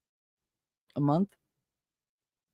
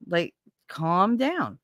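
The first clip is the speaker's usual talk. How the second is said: slow exasperation; 3 semitones higher, much slower than usual. Real, not acted. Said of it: "slowed for emphasis".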